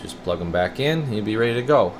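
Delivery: ordinary delivery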